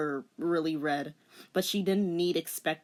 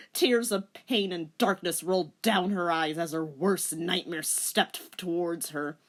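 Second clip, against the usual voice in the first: ordinary delivery, nothing marked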